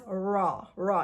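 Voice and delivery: "ra" with a very strong, curled R; "ra" slowly, tongue rolled upward; strong American R. Shown as a demonstration. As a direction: lean hard on the R of "ra". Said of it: "It's a very strong ra sound".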